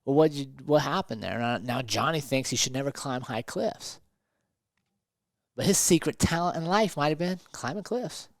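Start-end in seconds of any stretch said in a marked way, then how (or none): none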